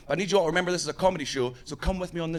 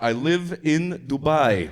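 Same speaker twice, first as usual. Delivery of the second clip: slow, mock Russian accent; 2 semitones lower, 4 dB louder, much slower than usual. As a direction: stretch it out as an evasive Russian. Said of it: "you sound like you might be Russian".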